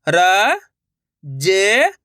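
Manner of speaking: र, जे each held long and rising; letter-by-letter demo. Shown as a demonstration. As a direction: sound out र and जे separately. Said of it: "एक अक्षर का उच्चारण सही से". (र, जे अलग-अलग खींचकर)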